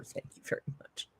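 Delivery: soft whispered thanks. Real, not acted. Streamer whispering thanks after a muttered curse. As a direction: breathe it as a whisper.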